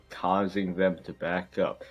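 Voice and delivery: normal talking voice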